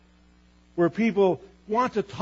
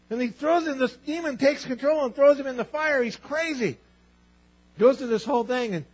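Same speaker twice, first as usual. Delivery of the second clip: normal talking voice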